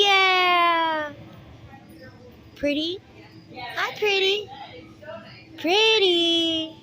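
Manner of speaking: high, loud sing-song cooing at a bird; pet talk. Real, not acted. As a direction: coo to the parrots like babies.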